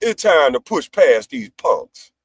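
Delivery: slow, stretched-out slogan; drawn-out, deliberate delivery; savoured catchphrase. Real, not acted. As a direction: relish each word, taking it slowly. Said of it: "I like the way that sounds".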